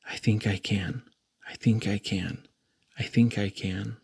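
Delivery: low, soft little engine voice; softly. Acted. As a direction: murmur it low like a tired small engine. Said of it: "he softly said".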